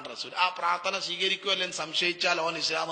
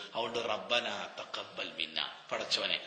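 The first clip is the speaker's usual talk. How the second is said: hushed prayer plea; 9 semitones lower, 8 dB quieter than usual. Acted. Same prayer sunk to a hush.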